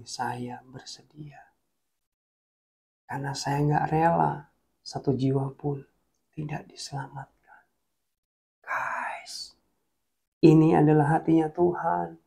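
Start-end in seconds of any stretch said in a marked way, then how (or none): 0.0-7.6 hushed. Acted. Quoting Jesus, speaker moved. 8.6-9.6 voice breaking. Real, not acted. Speaker breaks down.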